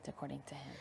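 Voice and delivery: whispered aside. Real, not acted. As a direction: drop to a whisper. Aside about Herman.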